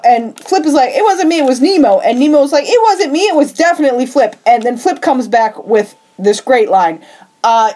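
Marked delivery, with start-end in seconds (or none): 0.9-2.0 higher-pitched hasty denial; mock protest. Flip blames Nemo before the crowd, mock protest. 2.7-4.4 high, quickening denial; mock protest. Nemo blames Flip right back, mock protest.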